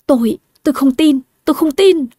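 high, broken denial; disbelieving cry. Acted. Betrayed wife cries out in disbelief to her rival. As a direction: refuse to believe it, voice cracking upward.

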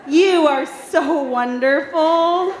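slow, loud mock gratitude with a laugh; sarcastic. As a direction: ham up the fake praise, laughing. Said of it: "mock thanks from family".

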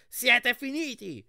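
high, loud, drawn-out taunt; slow high shout at opponents; shouted threat. A narrator's reading. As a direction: yell it across at a rival, stretching the words. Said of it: "ci gridò Lauriga di Apollo".

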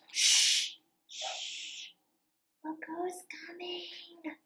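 slow hushed secret whisper. Acted. Reading to kids, hushed whisper as the farmer approaches. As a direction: hush the kids, someone's coming. Hushing before farmer arrives.

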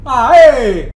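loud joyful whoop; excited cheer. Real, not acted. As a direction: cheer with relief. Celebrating hunter leaving.

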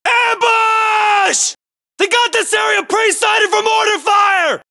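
long, drawn-out battlefield yell; alarm shout. Acted. Soldier warning squad of ambush and mortar fire, drawn-out shout. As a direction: bellow a warning to the whole squad.

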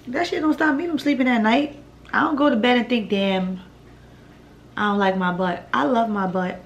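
normal talking voice

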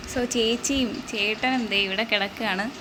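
ordinary talk, nothing marked

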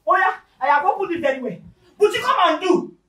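high, loud taunting call to come; loud mocking call. Wife shouting to husband to come and do. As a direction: yell it at him, daring him.

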